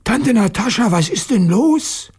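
high, bewildered schoolboy voice; puzzled. A narrator's reading. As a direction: ask in wonder, pitched well up. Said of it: "Petja fragte verwundert". (verwundert, mit hoher Stimme)

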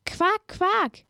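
high croaking quak; frog croak. Acted.

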